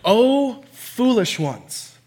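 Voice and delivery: drawn-out mock rebuke starting high, then dropping; Jesus. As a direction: chide them slowly and theatrically. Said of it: "Jesus rebukes them back".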